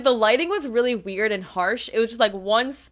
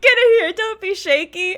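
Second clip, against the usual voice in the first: loud, higher imitation of a friendly order; playful ordering; 6 semitones higher, 7 dB louder than usual. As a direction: call them in for the photo. Imitates him pulling her in.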